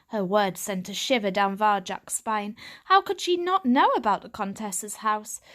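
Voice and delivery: ordinary delivery, nothing marked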